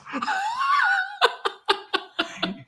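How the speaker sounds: big high laugh; loud laugh. Real, not acted. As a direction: burst out laughing. Laughing at his own impression.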